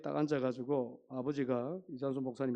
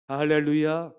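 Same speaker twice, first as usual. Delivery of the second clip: much louder hallelujah call; shouted hallelujah; 13 dB louder, slower than usual. Real, not acted. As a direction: call it out to stir the church. Preacher calls hallelujah louder.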